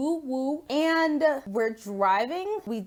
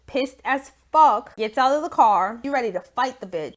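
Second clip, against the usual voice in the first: ordinary talk, nothing marked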